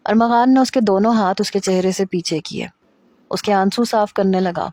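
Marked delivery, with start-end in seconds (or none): none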